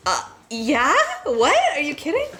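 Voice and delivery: retold shock, squealing in surprise; stunned disbelief. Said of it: "I was like".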